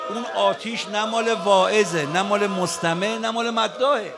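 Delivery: loud, emphatic preaching cadence; fervent preaching. Acted. Preacher telling mourners who owns the fire, fervent. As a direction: hammer each "نه مال" home.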